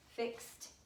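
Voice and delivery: fixed whispered, crisp final t; soft whispered fixed. Shown as a demonstration. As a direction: breathe out fixed with a sharp t. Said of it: "You're hearing a t".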